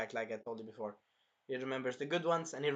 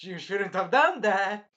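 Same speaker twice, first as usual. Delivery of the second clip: loud, raised, drawn-out warning; unhinged threat; 5 semitones higher, 9 dB louder, slower than usual. Retold or imitated. Hero answers the dragon, loud mock-angry threat. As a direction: menace it slowly, getting loud. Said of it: "He went full nuts".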